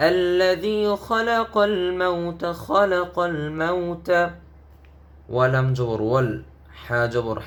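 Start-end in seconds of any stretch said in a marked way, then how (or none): 0.1-4.1 higher, slow chanted phrase; melodic recitation. Shown as a demonstration. Teacher modelling the phrase in tilawah. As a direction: recite like a qari. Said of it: "teacher models phrase".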